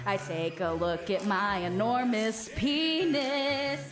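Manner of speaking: ordinary delivery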